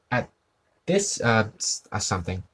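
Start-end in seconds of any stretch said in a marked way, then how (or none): none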